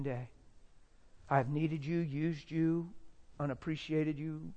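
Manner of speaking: soft confession, fading to near nothing; hushed, regretful admission; quiet remorse. Retold or imitated. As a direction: list each failing softly, with pauses. Husband's remorseful thoughts, retold.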